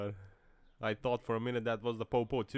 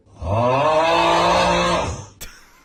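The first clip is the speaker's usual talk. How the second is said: very loud roaring laugh; loud drawn-out guffaw; loud laugh burst; 6 semitones higher, 16 dB louder than usual. Real, not acted. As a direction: explode into laughter.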